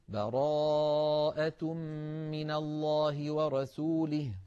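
slow chanted recitation after a fresh breath; Quranic recitation. Shown as a demonstration. Teacher demonstrating the new start, chanted recitation. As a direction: chant براءة من الله ورسوله slowly. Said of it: "ثم نقول".

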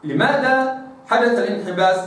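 usual speaking voice

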